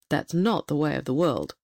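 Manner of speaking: low-pitched kingly voice. A narrator's reading. King corrects his hopeful queen, in a low kingly voice. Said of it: "said the king".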